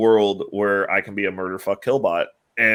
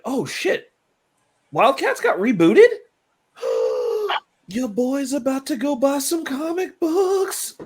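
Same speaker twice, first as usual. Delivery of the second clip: excited; slower than usual. Real, not acted. Sudden excited discovery.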